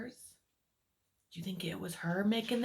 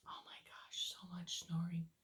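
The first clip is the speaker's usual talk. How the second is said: whisper; 5 semitones lower, 14 dB quieter than usual. Real, not acted. Whispered aside to child listeners.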